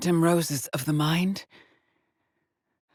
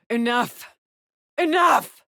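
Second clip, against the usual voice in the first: exasperated outburst; 7 semitones higher, 5 dB louder, slower than usual. Acted. Astronaut shouting down whatever is judging her, exasperated outburst. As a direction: cry out, rising to a loud, high shout. Snaps at being judged.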